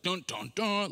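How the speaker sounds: voiced suspense stings rising; mock dramatic dun dun dun; dramatic music sting. Shown as a demonstration. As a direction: hum the ominous movie cue. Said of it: "we hear the music start to play".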